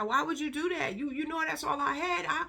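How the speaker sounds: high-pitched retold tearful plea; imitated crying. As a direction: mimic a distraught girl pleading, tearless. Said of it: "the girl is crying".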